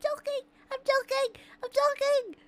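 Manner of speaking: "I'm joking" squeaked high and loud, laughing; squeaky repeats. Shown as a demonstration. Streamer teasing chat, repeating "I'm joking" in a high squeal.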